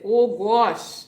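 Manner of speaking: loud, lilting Trini exclamation; Trinidadian accent. Shown as a demonstration. Streamer showing viewers her old exclamation, Trinidadian accent. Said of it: "insert in my Trini accent".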